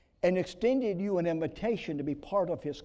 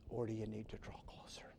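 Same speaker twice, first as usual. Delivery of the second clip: soft, low whisper; hushed altar call; 6 semitones lower, 15 dB quieter than usual. Acted. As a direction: gently press the final question. Closing hushed question.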